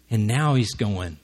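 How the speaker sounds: ordinary talk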